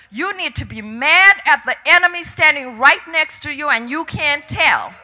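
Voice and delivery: emphatic, building preacher's delivery of God's word; forceful quoted command; the Lord's urging. Retold or imitated. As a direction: urge them passionately to pray. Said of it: "passionately pray the enemy out".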